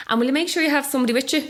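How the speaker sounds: quick high-pitched polite request; hospital caller. Retold or imitated. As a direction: lightly imitate a caller adding one last thing. Quotes caller's parting request.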